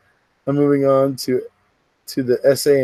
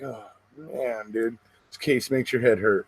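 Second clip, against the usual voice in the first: low-key, much softer aside; retold weary groan; 3 semitones lower, 8 dB quieter than usual. Streamer recalls puzzling over the case, quietly weary. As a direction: sigh it out softly, overwhelmed.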